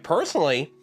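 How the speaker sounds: usual speaking voice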